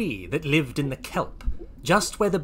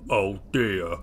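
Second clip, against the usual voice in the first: low voice of the biggest naughty creature; Big; 5 semitones lower than usual. Acted. Biggest naughty Lloyd replies to Oisin, in a low voice. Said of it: "inked Big".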